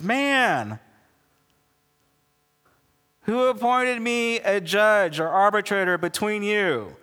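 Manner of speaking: high, indignant rebuke; incredulous at being dragged into it; exasperated Jesus. Acted. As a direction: push back in mock indignation. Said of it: "He's, like, got the nervous twitch".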